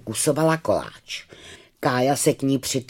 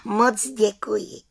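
grateful little witch; 9 semitones higher than usual. Acted.

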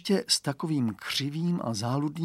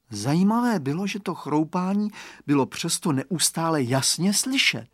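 usual speaking voice

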